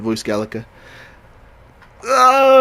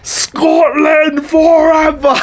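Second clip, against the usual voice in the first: high, drawn-out Scottish war cry, laughing; a hammed-up Scottish cheer stretched out long; mock Scottish battle cry; 9 dB louder, much slower than usual. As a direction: howl it slowly like a Scottish rallying cry. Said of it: "how do I do a Scotland voice".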